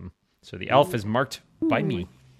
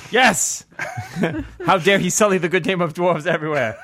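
loud, fast, laughing protest; laughing outburst; 7 semitones higher, 6 dB louder than usual. Real, not acted. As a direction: burst out laughing in mock outrage. Delighted at the turncoat reveal.

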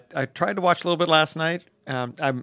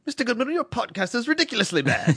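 high, put-on voice of a snooty listener; mock British critic; 10 semitones higher than usual. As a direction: play a sniffy British critic scolding the host. Mocking an imagined British critic.

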